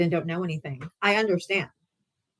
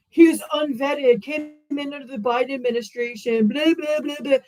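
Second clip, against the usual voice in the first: mocking argument; 5 semitones higher than usual. Streamer parroting reporters' argument, mocking and dismissive. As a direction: brush it off in a silly voice. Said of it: "they came out with the argument".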